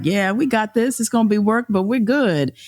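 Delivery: high, chipper can-do voice; upbeat confident voice. Pretend. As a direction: sound breezily sure of success. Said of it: "ten is like".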